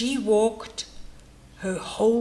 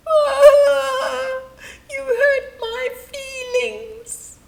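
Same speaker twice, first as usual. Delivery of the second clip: high wailing cry; 13 semitones higher, 8 dB louder, much slower than usual. Acted. Hippo wailing at a compliment, high and crying. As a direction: wail it out, voice way up, slow. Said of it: "she would wail".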